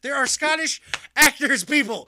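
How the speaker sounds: half-joking frustrated shout; exasperated rant. Retold or imitated. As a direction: throw up your hands at the casting. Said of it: "I'm like".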